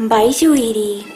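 ordinary talk, nothing marked

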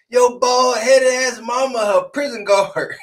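high-pitched playground-style roast; Yo Mama joke. Pretend. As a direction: deliver it as a mock Yo Mama jab. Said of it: "sound like a damn Yo Mama joke".

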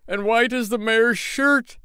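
high, slow dramatic telling; eager cry. Acted. Tailor adds details for the crowd, high and dramatic. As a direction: outdo his friend's details. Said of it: "cried the tailor".

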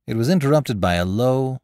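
ordinary delivery

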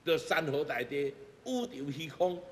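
ordinary delivery

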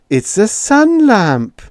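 proud reveal. A narrator's reading. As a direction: unveil it like a prize.

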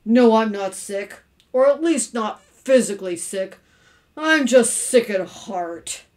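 loud, slow lament; heavy, mournful husband; sick at heart. A narrator's reading. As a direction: drag it out in hammed-up gloom. Mournful husband explains his gloom.

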